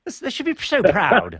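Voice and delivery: sarcastic pundit impression; mock commentator. Mocking the commentators' cliché again.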